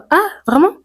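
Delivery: «Ah, vraiment?» monté très haut, étonné; surprise imitée. Pretend. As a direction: joue l'étonnement de la Réunionnaise. La Réunionnaise étonnée. (étonnement joué, voix haute)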